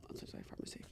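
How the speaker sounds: whispered aside, voice gone; real whisper. Real, not acted. Pausing the podcast to whisper to a pharmacy worker. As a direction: whisper it off-mic, unvoiced.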